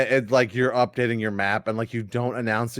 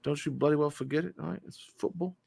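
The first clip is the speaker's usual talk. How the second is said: mock English accent, scolding; soft, high British "football" to finish; correcting pronunciation; 3 semitones higher, 6 dB quieter than usual. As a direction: drive home "football" in a put-on English accent. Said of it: "It's pronounced football".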